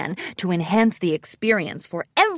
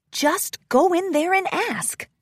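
higher-pitched self-coaching; small, high pep talk to oneself; talking to herself; 9 semitones higher than usual. A narrator's reading. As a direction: talk yourself into it. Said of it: "she told herself".

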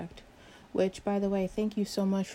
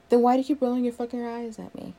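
louder, accusing question; raised voice, higher and irritated; angry confrontation; 4 semitones higher, 6 dB louder than usual. Acted. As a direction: demand an explanation, fed up. Acted quarrel, confronting coworker.

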